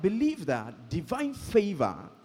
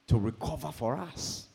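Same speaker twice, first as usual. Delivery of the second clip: usual speaking voice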